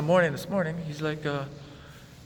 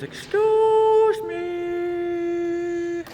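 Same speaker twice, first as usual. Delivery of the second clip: hugely stretched high falsetto; loud, very slow, squeaky mimicry; roommate impression; 6 dB louder, much slower than usual. Warning listeners about the roommate, exaggerated loud falsetto. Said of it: "if a guy ever goes".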